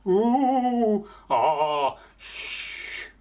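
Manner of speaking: spooky moan, sigh and hush, done playfully; imitated ghostly noises. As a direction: play the marsh spirits for fun. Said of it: "he tried to emulate these noises".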